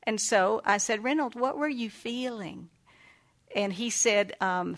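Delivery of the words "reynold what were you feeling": soft, slow counselor question; gentle asking. Retold or imitated. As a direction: invite him to open up softly. Counselor asks child gently.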